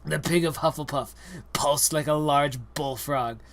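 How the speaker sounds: ordinary delivery, nothing marked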